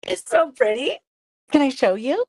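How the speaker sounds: high, loud and thrilled; delighted card maker showing off her work, excited. Real, not acted. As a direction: gush over how pretty it is.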